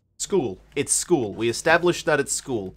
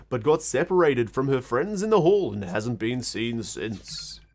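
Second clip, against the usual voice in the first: soft, steady newscaster read; news read; 2 semitones lower, 4 dB quieter than usual. Acted. Reading the missing-student newspaper, quiet newscaster voice.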